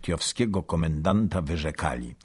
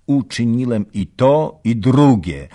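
proud boast; 7 semitones higher, 11 dB louder than usual. A narrator's reading. Soldier boasts of his deed.

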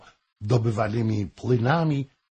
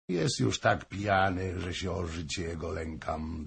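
subdued, dropping softer; quiet concern; 4 semitones lower, 6 dB quieter than usual. A narrator's reading. Prince fears for drunk Hoym's life, quietly. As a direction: murmur the worry, sinking away.

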